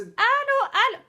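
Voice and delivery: high, loud repeated hello; calling out. Acted. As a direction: call down a dropping line. Calling into a failing video call.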